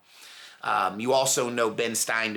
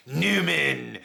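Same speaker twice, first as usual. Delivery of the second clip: mock disdainful Newman; a hint of Jerry Seinfeld's annoyed greeting; much slower than usual. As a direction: sneer the name like Jerry Seinfeld. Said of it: "he would be like".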